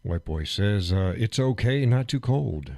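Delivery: ordinary talk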